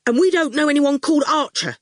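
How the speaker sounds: put-on quick, sharp boy's retort; snapping. A narrator's reading. Boy snaps back at the goon, quick and sharp. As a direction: snap it back fast. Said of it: "Howard snapped".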